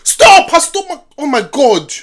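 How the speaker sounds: loud, high startled cry; real shocked yell easing off; horrified outburst. Real, not acted. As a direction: shout at the screen in horror. Horrified reaction to film scene.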